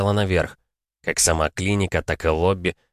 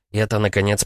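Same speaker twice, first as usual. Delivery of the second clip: plain reading voice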